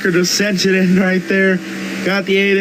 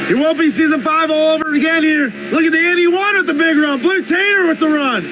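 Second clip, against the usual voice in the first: higher, race-caller voice rising with the action; live play-by-play; 8 semitones higher than usual. Acted. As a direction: call the race like a TV broadcaster.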